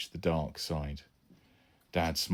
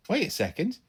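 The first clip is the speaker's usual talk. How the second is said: put-on boy's voice; 14 semitones higher than usual. Son's quick question.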